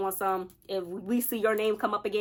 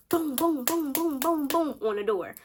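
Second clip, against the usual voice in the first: high, punchy knocking sounds; mock door pounding, pitched high; imitated banging; 6 semitones higher, slower than usual. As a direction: bang out the booms like a fist on the door.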